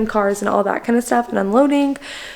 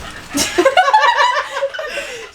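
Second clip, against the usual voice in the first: high laugh. Real, not acted. Laughing at hiding girl.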